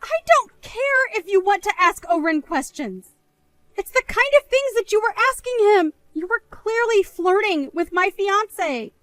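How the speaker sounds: high, slow, accusing; angry. Acted. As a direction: spell out each word, furious. Accuses sister of flirting.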